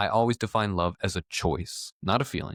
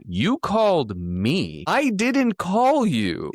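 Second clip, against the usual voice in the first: mock exasperated protest; 9 semitones higher, 4 dB louder, much slower than usual. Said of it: "And I'm like".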